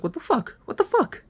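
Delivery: high-pitched disbelieving mock voice; baffled surprise. Man finds somebody's stuff in his Airbnb, baffled surprise.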